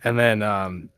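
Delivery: usual speaking voice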